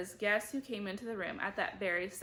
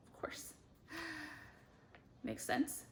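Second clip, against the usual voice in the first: whispered aside; 7 semitones higher, 10 dB quieter than usual. A narrator's reading. Narrator's dry aside.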